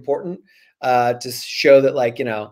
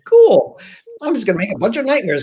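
much higher, louder gleeful villain; 7 semitones higher, 4 dB louder than usual. Retold or imitated. Author mimicking his silly villain, gleeful and high. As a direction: chirp it like a carefree villain. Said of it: "to be like".

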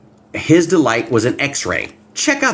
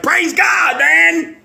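much higher shouted praise; joyful praise; much slower than usual. Retold or imitated. Believer seeing the sign after a bad day, shouting praise. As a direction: burst out in delight. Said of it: "you're like".

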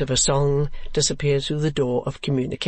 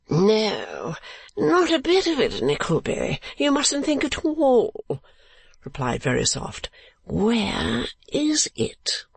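put-on foppish young lord; 9 semitones higher, slower than usual. A narrator's reading. Lord Verisopht voiced.

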